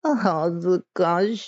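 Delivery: put-on very slow, high girlish coo; mimicked Japanese girl. Shown as a demonstration. Showing viewers how to mimic it, high girlish voice. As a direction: draw it out shyly, way up high. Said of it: "You gotta mimic my voice exactly".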